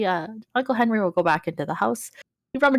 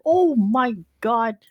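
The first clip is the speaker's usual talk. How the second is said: drawn-out, slightly higher dismay; Uncle Henry; 4 semitones higher, much slower than usual. Acted. Uncle seeing Cash worse for wear, slow drawn-out dismay. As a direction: stretch it out in slow concern. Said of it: "Cash is a little worse for wear".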